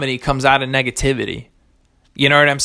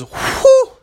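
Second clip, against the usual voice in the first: very high, loud whistled whew; high, loud whew of awe at the third season; hype whew; 6 dB louder than usual. Real, not acted. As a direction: burst out a piercing whew over the show. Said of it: "I'm gonna stop doing that".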